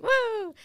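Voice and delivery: high, drawn-out party whoop. Retold or imitated. Both young and going for it, mock party whoop. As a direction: let out a mock woo.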